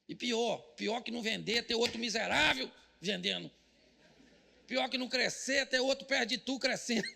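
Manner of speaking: normal talking voice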